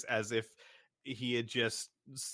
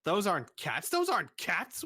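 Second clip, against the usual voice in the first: higher and louder, rising protest; indignant; 6 semitones higher, 5 dB louder than usual. Acted. Shopkeeper indignantly rejecting the wallets in a higher, louder protest. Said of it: "He looks at the wallets".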